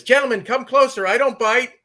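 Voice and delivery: high, loud call across a room. Retold or imitated. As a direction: beckon people closer from far off. Actress calls up to back of room.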